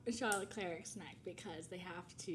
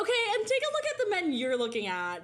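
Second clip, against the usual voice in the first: high, loud mock outrage; indignant retort; 10 semitones higher, 8 dB louder than usual. Retelling her retort to a friend who dissed her crush, indignant. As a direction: fire back in exaggerated offense, laughter underneath.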